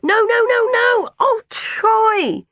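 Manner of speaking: horrified high scream; full-voiced panicked cry. A narrator's reading. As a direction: scream out in alarm, very high and loud. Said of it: "I was screaming too".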